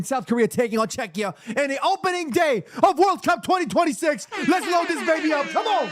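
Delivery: building hype, rising high; match hype. Real, not acted. Host announces the opening World Cup match, building excitement. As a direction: build it up to a rallying cry.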